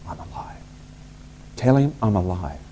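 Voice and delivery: hushed whisper, then barely voiced. Joseph whispers to his brothers, hushed. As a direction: lean in and whisper the secret.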